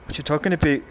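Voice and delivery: mock London accent, strongest at the start; thick London accent. Streamer ribbing a little whippersnapper, mock London accent. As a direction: put on a rough go at a London accent. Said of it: "talk with a real thick accent".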